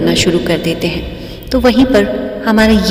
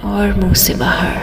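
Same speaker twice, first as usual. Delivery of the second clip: guided meditation. Real, not acted. Guide leading listeners into breathing and relaxation, meditative. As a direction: lead listeners unhurriedly through each breath.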